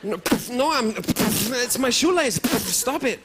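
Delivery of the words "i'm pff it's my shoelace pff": usual speaking voice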